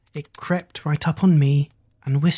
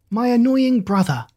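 bleating sister; 8 semitones higher, 4 dB louder than usual. A narrator's reading. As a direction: bleat it out in a higher voice. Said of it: "my sister did bleat".

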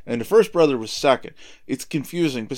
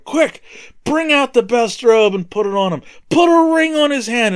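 eager father's orders; 7 semitones higher, 4 dB louder than usual. Acted. Father orders servants at homecoming.

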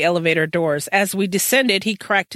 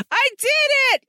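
excited shout; faster than usual. Acted. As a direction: yell it like you just pulled off the impossible. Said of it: "And Game Boy shouted".

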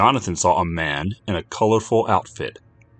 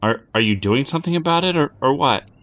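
put-on stammering kid; 7 semitones higher than usual. A narrator's reading.

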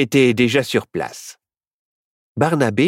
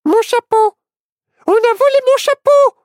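very high, loud alarmed cry; shocked exclamation; 7 dB louder than usual. A narrator's reading. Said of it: "s'exclama soudain".

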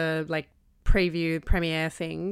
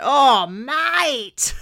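loud, high appalled holler; imitated yell; 6 semitones higher, 5 dB louder than usual. Mimicking a man behind at the cinema, yelled out. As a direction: yell it like a scandalised moviegoer. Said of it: "guy behind me just yelled out".

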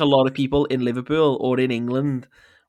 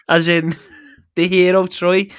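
usual speaking voice

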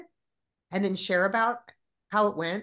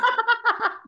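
loud high laugh; 14 semitones higher, 6 dB louder than usual. Real, not acted. Reacts to host's joke.

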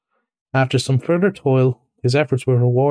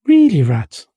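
louder, higher, peevish reproach; petulant and put out; pettish; 4 semitones higher, 5 dB louder than usual. Acted. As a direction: rebuke a friend testily. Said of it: "said the mole quite pettishly".

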